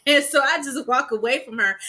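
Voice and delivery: normal talking voice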